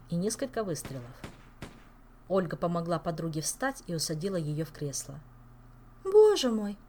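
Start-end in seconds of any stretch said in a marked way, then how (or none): 6.0-6.8 high shocked cry; horrified. Acted. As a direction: gasp it in horror. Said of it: "ужаснулась она".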